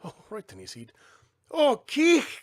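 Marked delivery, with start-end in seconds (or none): 1.5-2.4 high, crying out in dismay; a small mole's upset cry; cried out. A narrator's reading. Mole cries out at the mess on his head. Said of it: "cried the wee Maudie".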